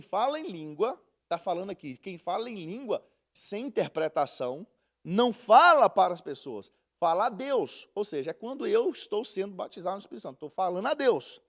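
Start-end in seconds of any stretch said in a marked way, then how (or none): none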